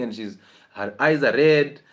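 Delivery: ordinary talk, nothing marked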